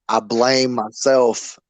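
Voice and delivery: ordinary talk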